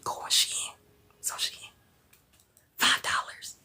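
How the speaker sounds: unvoiced secret whisper. To the ladies in the stream, a secret whisper. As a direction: lean in and whisper it like gossip. Secret nail salon price.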